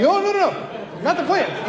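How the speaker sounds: high, slow panicked no no no; retold alarm at the cop answering; mock panic.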